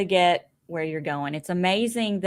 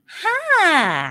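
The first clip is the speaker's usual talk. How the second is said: high, loud drawn-out Southern "hah"; grandson's Southern accent; 9 semitones higher, 5 dB louder than usual. Retold or imitated. Grandson greeting grandmother, imitated high Southern drawl. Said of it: "my grandson's getting a Southern accent".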